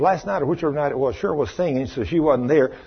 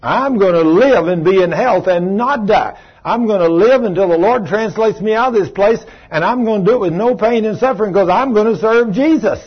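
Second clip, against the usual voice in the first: bold declaration; 5 semitones higher, 4 dB louder than usual. Shown as a demonstration. Preacher modeling the declaration for believers, bold and loud. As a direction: proclaim it with full conviction.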